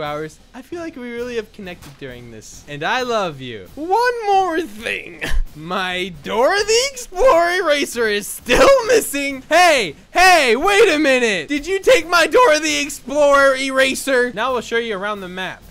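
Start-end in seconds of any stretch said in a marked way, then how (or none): 3.7-14.3 put-on high, loud kid voice yelling through laughter; hammed-up accusing schoolkid; whiny kid accusing. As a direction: demand the eraser back like a kid. Kid character accusing classmates.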